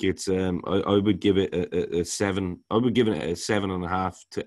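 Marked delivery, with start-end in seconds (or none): none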